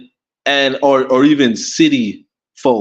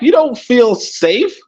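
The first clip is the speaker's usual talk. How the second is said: ordinary talk, nothing marked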